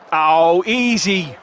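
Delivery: loud, high celebratory call; loud goal cheer. Real, not acted. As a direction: crow over an easy goal.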